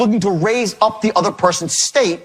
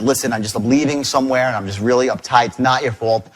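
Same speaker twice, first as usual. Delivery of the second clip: lower, calmed-down explanation; retold gentle apology; reassuring; 6 semitones lower than usual. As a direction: soothe the caller you upset. Retold reassurance to crying caller.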